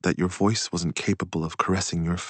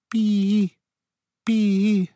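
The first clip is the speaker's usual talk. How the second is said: high, loud, drawn-out cheep; pet's crying call; 6 dB louder than usual. A narrator's reading. Narrator imitates the dying Bibi's crying, high and plaintive. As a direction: imitate a small creature's piercing cry. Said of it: "who died crying".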